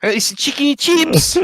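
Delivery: high, slow British squeal; mock English accent. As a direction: drag it out in a shrieky British caricature. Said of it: "it's not chips".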